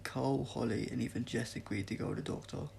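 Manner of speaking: usual speaking voice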